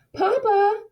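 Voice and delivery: high, loud call across the snow. A narrator's reading. Girl calling after her father, a high loud shout. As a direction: call out to a far figure. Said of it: "she called as she ran".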